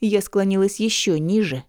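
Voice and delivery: plain reading voice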